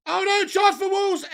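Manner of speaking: high, loud cry of alarm; alarmed shout. Real, not acted. Watchalong host alerts viewers to a Wolves chance, alarmed shout. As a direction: shout it as danger suddenly appears.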